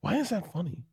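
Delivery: soft, high, baffled self-question; puzzled to self. Retold or imitated. Comic on stage asking himself about a joke, soft and baffled. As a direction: wonder aloud under your breath.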